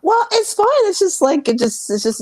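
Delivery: high, loud brushing off; defensive. Real, not acted. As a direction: wave off the worry. Brushing off concern.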